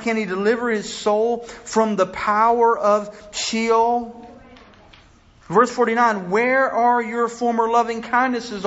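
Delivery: high, loud impassioned scripture cry, pleading with God; full-force preaching. Acted. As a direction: pour out the psalmist's lament at full force. Preacher reading lament at full force.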